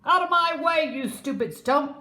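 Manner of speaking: loud, high furious shout; angry snort. Acted. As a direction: snort at the stump in rage. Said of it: "he snorted".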